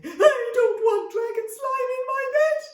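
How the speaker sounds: very high, loud whiny protest; wailing child. Acted. Daughter protesting the dragon's bedtime plan, wailing. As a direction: wail like a little girl refusing.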